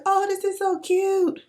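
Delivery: very high squeal of delight; gushing. Retold or imitated. Fan seeing her crush at the party, squealing high. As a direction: gush like a starstruck fan. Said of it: "I was like".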